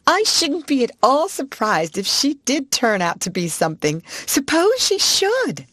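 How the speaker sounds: broke out. Acted. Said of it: "Jessie broke out".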